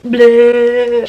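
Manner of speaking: luide spottende "bleh"; loud mocking bleh. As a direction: blaas hem spottend na. Kicking him out, mocking.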